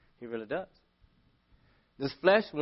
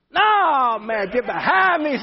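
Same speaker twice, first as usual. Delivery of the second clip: high, loud wail of refusal; acted loud protest; 10 semitones higher, 8 dB louder, slower than usual. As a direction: cry out against an unwelcome order, hammed-up. Acted protest at being told to help.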